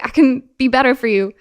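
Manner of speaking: high, loud eager promise; acted needy eagerness; people-pleasing. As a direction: plead to be liked. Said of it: "this deep people-pleasing habit".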